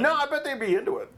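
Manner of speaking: laughing while joking. Real, not acted. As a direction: laugh through the line.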